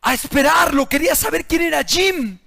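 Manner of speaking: full-force sermon voice; fiery preaching. Acted. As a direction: drive the warning home from the pulpit.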